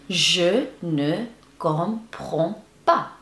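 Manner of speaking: very slow, one syllable at a time; spaced-out teaching demo; slow model pronunciation. Shown as a demonstration. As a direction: separate every word for learners. Teacher models phrase slowly.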